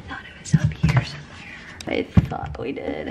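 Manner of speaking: breathy whisper to herself. Real, not acted. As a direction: mutter under breath searching. Unvoiced while searching.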